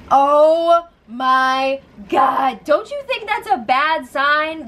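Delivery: long drawn-out oh my God; exasperated disbelief. Real, not acted. Host reacting to a cocky houseguest, drawn out in disbelief. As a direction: stretch it out in disbelief.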